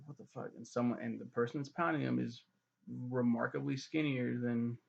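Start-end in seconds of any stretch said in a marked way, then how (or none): 0.1-0.5 breathy stunned whisper as if peeking in secret; shocked whisper. Retold or imitated. Bran peeks into the tower, stunned hushed whisper. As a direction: hush it, like Bran catching them. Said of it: "It's like".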